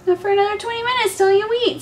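high, fast pet talk. Real, not acted. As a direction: chide the cat playfully. Talking to the cat.

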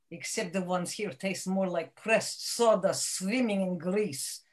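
normal talking voice

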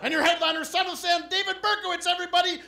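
high, loud MC introduction; show announcer. Acted. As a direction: hype the headliner to the crowd. Acts MC introducing headliner.

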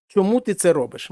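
ordinary talk

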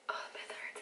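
whispered ow of pain. Real, not acted. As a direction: breathe out the pain.

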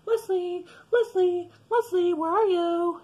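high, sing-out search call; mom calling out. Retold or imitated. Mom trying to find her hiding kid, calling out high. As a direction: imitate a mother calling for a missing child.

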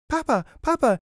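put-on very high, loud child's demand; crying-out child. A narrator's reading. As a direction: pipe up loudly as a small child. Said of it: "cried one".

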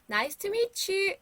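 slow, high, careful "Nice to meet you."; slow model phrase. Shown as a demonstration. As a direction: say each word slowly for children to copy. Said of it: "우린 이렇게 얘기를 해요". (천천히 또박또박 높게 "Nice to meet you.")

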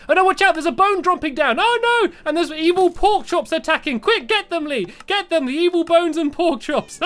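high, loud frantic cries; panicked alarm. Real, not acted. Urging Lee to fight the attacking mobs, high frantic yelling. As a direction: yelp in sudden panic.

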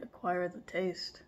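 speaking with seaweed between teeth; words muffled mid-bite; biting into a snack. Real, not acted. Seen 0: Lifts seaweed sheet to mouth and bites while saying "the taste.

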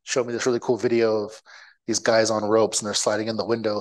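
normal talking voice

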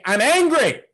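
loud, high shout of anger; angry proclamation. Acted. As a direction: shout it out of an open window. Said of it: "open up our window and say".

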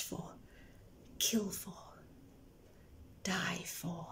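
very soft dying whisper. Acted. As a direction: let it fade to almost nothing. Poem, whispered climax drop.

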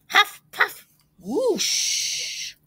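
high, puffed sound effects; acted huffing and blowing. A narrator's reading. Wolf blowing down the stick house, puffed whoosh. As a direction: blow the words, then a long whoosh.

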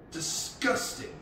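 slow, high, drawn-out revulsion; disgusted. A narrator's reading. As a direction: stretch the word out in disgust. Father bird revolted by worm burgers.

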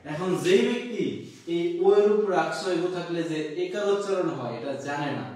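ordinary delivery

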